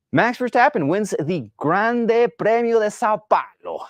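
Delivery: mock Italian accent, pitched high and sung out; hammed-up Italian announcer calling the winner. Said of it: "I said that in an Italian accent".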